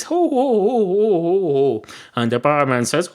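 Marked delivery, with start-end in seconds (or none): 0.0-1.8 high-pitched run of ho-ho-hos, falling away; mock delighted hooting. Cat seeing a pint of Baileys appear, mock delighted hooting. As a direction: start the hoots high and let them slide down.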